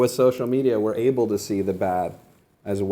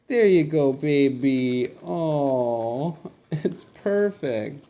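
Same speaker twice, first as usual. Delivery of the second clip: gushing high coo to a pet; 5 semitones higher, much slower than usual. Real, not acted. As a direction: fawn over an adorable animal. Cooing to the animals.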